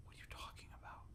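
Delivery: high, fast whispered complaint; disbelieving whisper. Real, not acted.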